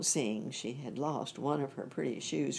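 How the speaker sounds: usual speaking voice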